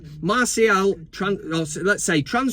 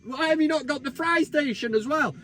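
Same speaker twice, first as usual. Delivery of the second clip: mock rant at waiter; 8 semitones higher than usual. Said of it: "I'd say".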